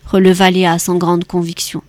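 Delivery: usual speaking voice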